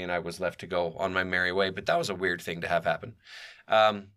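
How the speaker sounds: usual speaking voice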